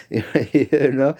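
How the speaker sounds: laughing you know. Real, not acted. Laughing at dad's advice.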